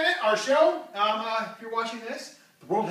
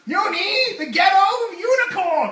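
loud guest announcement; 7 semitones higher, 9 dB louder than usual. Real, not acted.

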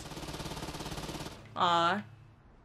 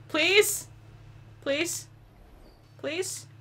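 softer and softer rising pleas; fading plea; 3 semitones higher, 7 dB quieter, much slower than usual. Real, not acted. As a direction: beg as hope dies away. Begging train, fading hope.

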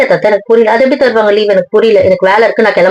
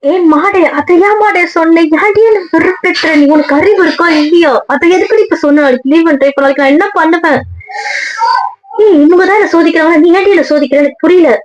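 much higher, shrieking frustration at her friend; high-pitched angry telling-off; exasperated scolding; 9 semitones higher than usual. Acted. Scolds friend for mentioning leave.

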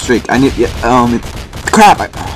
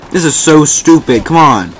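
usual speaking voice

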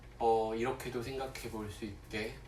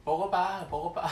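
higher, louder coaxing tease; tempting pepper voice; 7 semitones higher, 5 dB louder than usual. Acted. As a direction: lure the listener to take a bite. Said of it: "막 유혹하다가". (먹어보라고 꼬드기는 고추 목소리)

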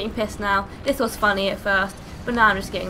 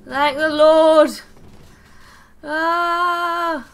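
relief; 5 semitones higher, 10 dB louder, much slower than usual. Real, not acted. Relief after danger.

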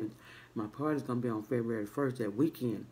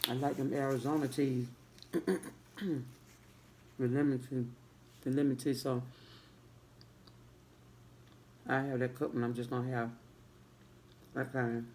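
talking with food in mouth; chewing while talking; 4 dB quieter than usual. Real, not acted. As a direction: between bites of bread. Seen 1: took bite before; holds bitten bread, lips working throughout.

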